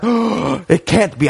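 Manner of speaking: high, very slow, astonished; imitating Doc's slow, high, shocked disbelief; stunned disbelief. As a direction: draw it out like you've seen a ghost. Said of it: "Doc really freaks out".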